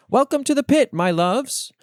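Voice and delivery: high, loud welcome blared over a loudspeaker; sing-song public address call, high and loud; loudspeaker greeting. Acted. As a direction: greet the whole city like a cheery announcer. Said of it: "heard by born babies ... over loudspeakers".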